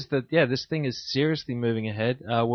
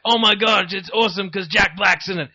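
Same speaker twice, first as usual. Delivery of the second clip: gushing fanboy, higher and louder; mock excitement; 7 semitones higher, 5 dB louder than usual. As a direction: gush over it like a dazzled fan. Mocking hyped fan reaction.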